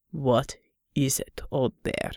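low, slow, weary gruff man. Acted. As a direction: drag it out, low and tired. Unter answers Pavel, weary.

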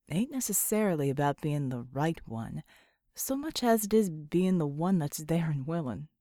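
put-on a laconic old hand giving advice; Johnny Murdoch's voice. A narrator's reading. Johnny Murdoch quoted, drawled voice.

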